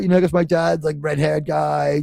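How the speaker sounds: ordinary talk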